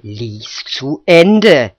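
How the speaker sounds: very slow, loud order; woodcutter's insistent, menacing command; threatening. A narrator's reading. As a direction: stretch each word into a warning. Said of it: "drohte Josef Krischan".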